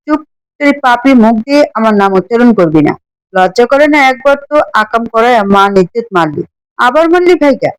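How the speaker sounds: ordinary delivery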